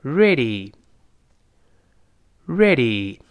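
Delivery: "ready" with curled R and final "ee", falling; careful repeat-after-me "ready"; model reading. Shown as a demonstration. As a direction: say "ready" slowly for learners, leaving a gap. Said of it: "我们再读一遍".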